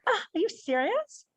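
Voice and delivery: high, rising mock disbelief; sarcastic. Shown as a demonstration. Teacher helps a stuck student with an example, sarcastic. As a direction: sigh it out high as a sarcastic question. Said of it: "If that's sarcasm".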